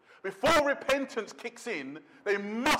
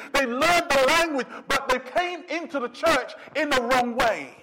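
louder, driving pulpit delivery; full-force preaching; 4 semitones higher, 5 dB louder than usual. Real, not acted. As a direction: preach it out hard to the congregation.

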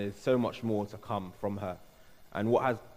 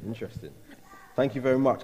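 usual speaking voice

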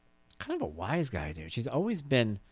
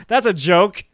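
loud, high scoffing laugh around the words; mocking laugh; 10 semitones higher, 14 dB louder, slower than usual. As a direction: laugh it off at dad. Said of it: "She goes".

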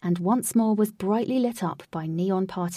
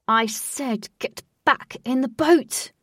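firm loud repeat; 5 semitones higher, 5 dB louder, slower than usual. Acted. Wraith repeating an order.

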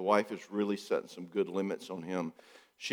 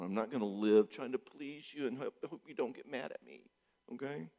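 calm refusal; 10 dB quieter than usual. Retold or imitated. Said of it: "And I go".

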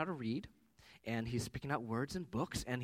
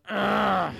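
frustrated groan; 6 semitones higher, 12 dB louder than usual. Retold or imitated. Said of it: "this little groan".

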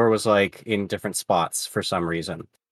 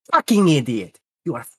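put-on higher, scornful Russian-accented insult; thick Russian accent; 4 semitones higher than usual. Russian grandpa pointing at the worker's face, scornful Russian accent. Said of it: "he's got a thick Russian accent".

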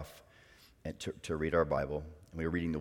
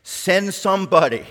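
fervent prayer; 8 semitones higher, 14 dB louder than usual. Retold or imitated. Praying for someone to start a chapel, fervent.

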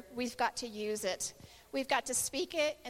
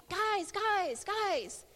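retold calling out; 8 semitones higher, much slower than usual. Son returning to camp, calling out. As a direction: imitate a boy calling to the group. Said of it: "He's yelling".

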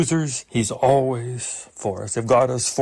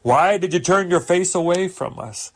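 pleading prayer, climbing higher; raised-pitch plea, mildly acted; praying David; 5 semitones higher than usual. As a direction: cry out to God in appeal. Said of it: "David is praying all the time".

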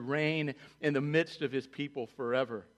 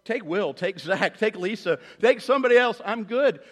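fast, higher, louder comic fear; hammed-up frantic bargaining; mock panic; 6 semitones higher, 8 dB louder, faster than usual. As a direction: scramble to pass the job on. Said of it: "I'd be like".

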